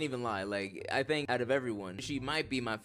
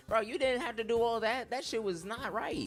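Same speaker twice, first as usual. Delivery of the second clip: exasperated; 9 semitones higher than usual. Real, not acted.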